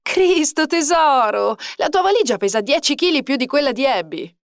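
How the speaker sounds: groaning protest. A narrator's reading. Boyfriend complaining about her heavy suitcase, groaning protest. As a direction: drag out the first words in loud protest. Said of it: "protestare tra un gemito e l'altro".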